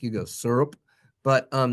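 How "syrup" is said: syrup said as sur-up; regional pronunciation. Shown as a demonstration. Teacher shows how some Americans say syrup, regional pronunciation. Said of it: "if you're of a certain ilk".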